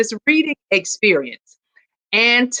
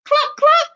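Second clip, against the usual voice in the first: very high clucking; chicken clucks. Acted. Chicken answers Duck's hello, high hen clucks.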